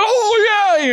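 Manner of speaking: high, drawn-out burst of recognition; imitating delighted recognition. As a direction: act out lighting up at a fond memory. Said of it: "George lit up".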